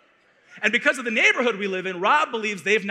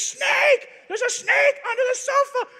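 very high, loud panicked shout; panicked scream; 3 dB louder than usual. Retold or imitated. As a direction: scream it, terrified.

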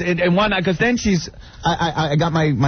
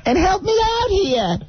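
put-on Boston accent; 12 semitones higher than usual. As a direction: mimic the mother-in-law with a Boston accent. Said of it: "with the Boston accents".

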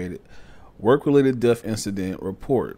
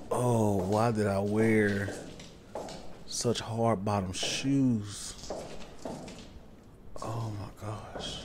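slow hushed; 8 dB quieter, slower than usual. Real, not acted. Sneaking past monster in game.

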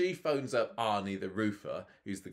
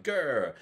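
loud, higher playful "grr"; hammed-up growl of anger; mock angry growl; 6 semitones higher, 6 dB louder than usual. Acting out Alia's anger.

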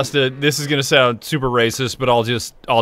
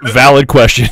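laughing; 10 dB louder than usual. Retold or imitated. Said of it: "Or chat is like".

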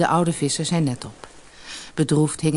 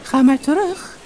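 high, louder little fish voice; talking goldfish; 11 semitones higher, 5 dB louder than usual. A narrator's reading. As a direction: send him back in a high voice. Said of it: "zei het visje".

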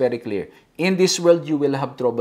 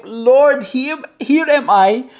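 slow, raised-pitch prayer of devotion; declaimed prayer; 5 semitones higher, slower than usual. Acted. Preacher voices the psalmist offering himself to God, fervent prayer. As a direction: call out to God in slow, emphatic phrases.

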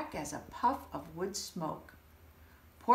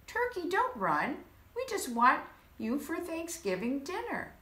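higher pleading call while chasing; coaxing; 8 semitones higher than usual. Acted. Mister Moose chasing Turkey, coaxing higher. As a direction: reassure the fleeing bird.

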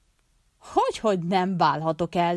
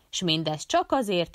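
usual speaking voice

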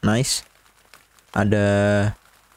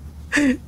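laughing; 13 semitones higher than usual. Real, not acted. Gamer laughing at game.

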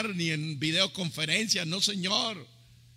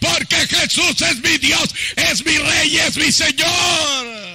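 loud, high, impassioned preaching shout; triumphant proclamation; 6 semitones higher, 8 dB louder than usual. Retold or imitated. Peter realises it is Jesus on the waters, triumphant shouting. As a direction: build to a roaring climax, stretching the final words.

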